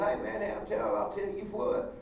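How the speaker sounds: a hint of cartoon Texas twang; mock Texas accent. Mocking cartoon dialects, light Texas accent. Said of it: "not everyone from Texas going".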